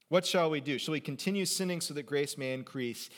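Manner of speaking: ordinary talk, nothing marked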